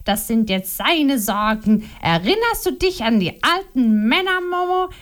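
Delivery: mock her husband's excuses with scorn; Liliana, accusing. A narrator's reading. Wife accusing her husband before Momo, scornful.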